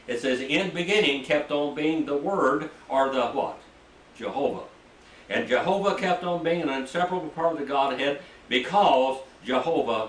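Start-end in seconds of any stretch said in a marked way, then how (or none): none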